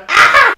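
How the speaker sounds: sudden high shriek of labour pain; loud anguished scream; retold scream. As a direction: let out a piercing movie-style scream. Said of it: "speeding 'cause I'm screaming".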